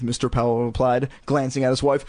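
ordinary delivery

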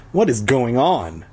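usual speaking voice

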